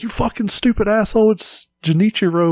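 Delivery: half-hearted imitation of an outraged fan; mild mock yell. Fan correcting the host at their phone, mild mock yell. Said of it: "Somebody's gonna scream at their phone".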